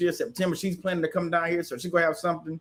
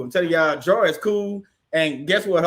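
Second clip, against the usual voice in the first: ordinary talk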